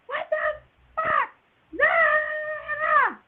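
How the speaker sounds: very high, loud retold shriek; freaking out. Patient as baby's heart rate drops, freaking out. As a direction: shriek in panic, drawing out the last word. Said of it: "So I'm freaking out".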